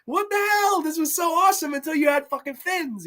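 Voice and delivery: very high, incredulous squeal; hammed-up disbelief, voice way up high; mock outrage. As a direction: protest in a shocked high-pitched voice. Acts man's shocked complaint.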